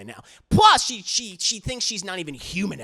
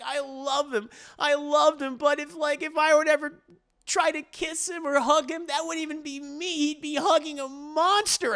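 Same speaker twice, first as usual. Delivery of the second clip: high distressed lament; 11 semitones higher than usual. Retold or imitated.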